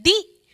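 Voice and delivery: दी said short, high and loud; a brief dee with short vowel. Shown as a demonstration. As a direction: pop a quick दी. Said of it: "इसको हम धीरे से उच्चारित कर रहे हैं".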